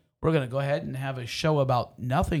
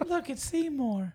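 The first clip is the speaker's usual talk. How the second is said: put-on kids' voice; 12 semitones higher, 6 dB quieter, slower than usual. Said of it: "And everybody say".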